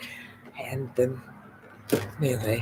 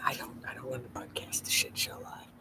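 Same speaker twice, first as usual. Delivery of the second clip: whispering; 11 dB quieter than usual. Real, not acted. Whispered aside on camera.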